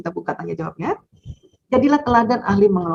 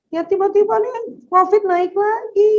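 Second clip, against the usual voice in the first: put-on much higher, slow child voice; child's whine; 12 semitones higher, slower than usual. Child whining to mother that covid is rising again. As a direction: play the upset kid explaining. Child explaining why upset.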